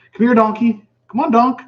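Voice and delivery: high, loud sing-song dog call; calling a puppy. Pretend. Owner calling his imagined puppy Donkey, high excited call. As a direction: call the pup over, way up high.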